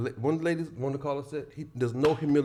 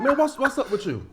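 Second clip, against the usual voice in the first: retold confrontation; 11 semitones higher than usual. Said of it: "I wanted to ask them".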